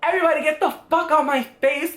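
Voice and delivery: high-pitched mock outburst; acted-out drunken snapping at everyone; talking crazy. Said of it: "I was talking crazy".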